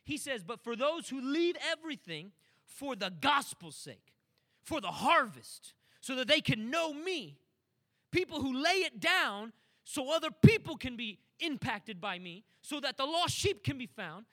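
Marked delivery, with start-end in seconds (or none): 0.4-7.4 emphatic preaching. Acted. Preacher quoting Jesus with force. 8.1-12.4 forceful preaching. Real, not acted.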